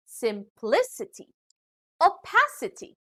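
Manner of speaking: "simplicity, opacity" with a punch on PLI and PA; stress before ending. Shown as a demonstration. Teacher showing rule four, "simplicity, opacity" stressed before the ending. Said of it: "the syllable before these endings".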